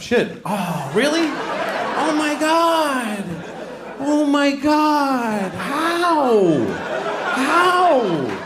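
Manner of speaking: high, laughing gasps of disbelief; shocked disbelief. Acted. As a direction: squeal out the shock, slow and high. Shocked reaction to Louis news.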